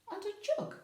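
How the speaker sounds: a jump up high on jug; playful high. A narrator's reading. Teacher showing the picture, a playful high lift. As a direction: pop the last word up.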